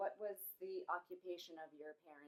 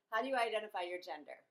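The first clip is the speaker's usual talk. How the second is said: louder, clearer repeat of the question; 3 semitones higher, 11 dB louder, faster than usual. Real, not acted. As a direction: say it again louder so she hears. Repeats question after misunderstanding.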